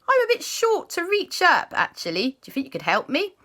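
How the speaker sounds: high, sweet puppy asking for help; Sky's voice. Acted. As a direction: ask shyly like a little dog. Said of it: "And Sky said".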